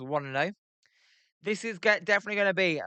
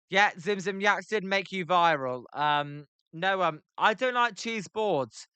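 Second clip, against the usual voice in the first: usual speaking voice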